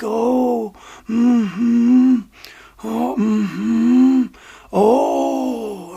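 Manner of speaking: put-on drawn-out old-man murmuring; imitated old man. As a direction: hum along slowly like an old man. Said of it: "he's talking like an old man".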